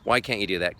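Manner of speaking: normal talking voice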